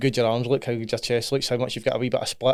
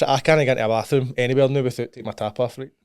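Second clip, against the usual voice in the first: usual speaking voice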